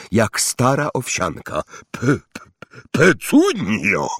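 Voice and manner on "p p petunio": stammered, drawn-out breathy name; stutter, then push the name out on one breath; shocked gasp. A narrator's reading. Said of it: "wyrzucił z siebie wraz z oddechem". (jąkanie z przerażenia, na wydechu)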